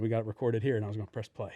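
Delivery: usual speaking voice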